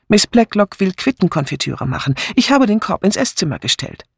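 high-pitched lady's voice; chatty neighbour woman. Acted. Visiting neighbour explaining herself to the sergeant, high chatty voice. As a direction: explain the errand in a lifted voice.

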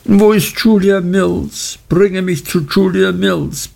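hohe, aufgelöste Frauenstimme; außer sich. A narrator's reading. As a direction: verzweifelt nach der Freundin rufen. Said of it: "Dora war außer sich". (außer sich, hoch und flehend)

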